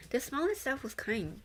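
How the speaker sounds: ordinary delivery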